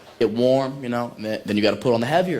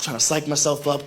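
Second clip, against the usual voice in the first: ordinary delivery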